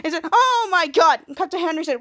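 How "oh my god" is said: loud, higher shocked exclamation. Acted. Henry seeing his sister's hair, shocked. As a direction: gasp it out in acted horror. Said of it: "all this shocked feeling".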